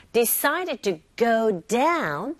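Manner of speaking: slow model phrase, lean on down, "to" light; stress on DOWN. Shown as a demonstration. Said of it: "부사 쪽에 힘을 실어서". (down에 힘을 실어 천천히)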